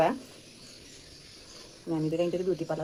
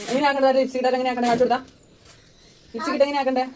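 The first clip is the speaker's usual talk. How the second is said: higher, louder coaching tone; coaxing; 5 semitones higher, 6 dB louder, faster than usual. Real, not acted. Teaching her daughter to press her lips, bright and louder.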